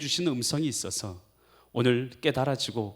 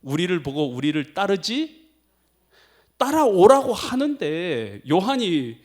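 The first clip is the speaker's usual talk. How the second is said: ordinary delivery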